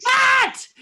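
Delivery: high, explosive shout of outrage; so mad. Retold or imitated. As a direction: imitate a furious producer yelling. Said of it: "he is so mad".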